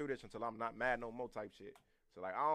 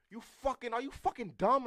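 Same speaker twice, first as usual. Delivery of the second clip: high-pitched mock scolding; imitated cussing out; 9 semitones higher, 4 dB louder than usual. Woman cussing people out, imitated high scolding. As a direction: cuss someone out in a raised, higher voice. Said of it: "seeing them bitches cuss people out".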